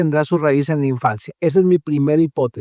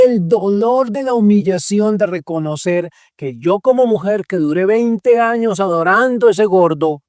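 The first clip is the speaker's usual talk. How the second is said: ordinary talk, nothing marked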